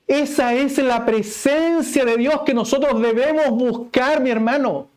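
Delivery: high, loud, impassioned preaching; preaching at full force. Acted. Preacher driving home the mark of a true church, full force. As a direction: proclaim it to the whole congregation.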